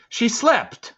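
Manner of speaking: very high, loud protest; defensive. A narrator's reading. Boy explaining grandma's fall to an officer, high-pitched and defensive. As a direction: jump to defend her, pitch shooting up. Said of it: "I replied defensively".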